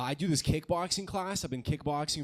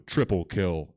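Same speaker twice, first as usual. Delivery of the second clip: deep booming game announcer, lower and louder; Halo announcer; 9 semitones lower, 6 dB louder, slower than usual. Retold or imitated. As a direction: boom it out like a video game announcer. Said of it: "I heard the announcer from Halo".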